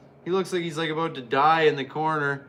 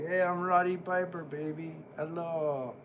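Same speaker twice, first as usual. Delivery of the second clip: flirty Roddy Piper; 6 dB quieter than usual. Retold or imitated.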